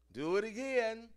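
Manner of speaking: a hint of a strict conductor stopping the choir; imitating choir director. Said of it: "It Must Be Perfect".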